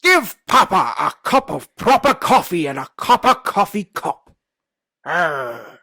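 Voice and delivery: loud, slow hammed-up pirate accent with a laugh. Friend's challenge, pirate accent, loud and laughing. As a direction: growl it out like a one-eyed pirate. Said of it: "the accent is a pirate accent".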